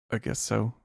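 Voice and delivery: barely audible, defeated; a mild quiet shrug of a line; flat resigned mumble. Retold or imitated. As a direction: mumble it like she's given up. Voicing depressed woman.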